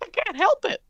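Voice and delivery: high squeaky cartoon protest; Mickey Mouse. Character defends his voice to the host, squeaky cartoon mouse. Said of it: "stop being Mickey Mouse".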